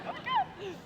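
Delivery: high, breathless starstruck squeal. Retold or imitated. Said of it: "And I was like".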